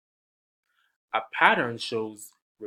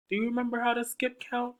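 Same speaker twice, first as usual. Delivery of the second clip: high, fast playful question; bright quick teacher question; 12 semitones higher, much faster than usual. Acted. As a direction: quiz the kids brightly.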